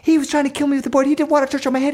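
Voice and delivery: fast, high-pitched tattling; kids talking over each other. Acted. Two brothers blame each other to mom, high frantic gabble. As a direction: rush both accusations out in one high gabble. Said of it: "we both talked at the same time".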